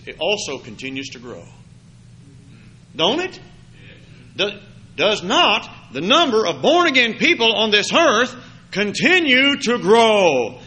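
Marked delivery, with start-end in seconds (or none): 2.9-10.6 loud fervent preacher voice; preaching. Acted. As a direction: drive it home from the pulpit. Preaching at full force.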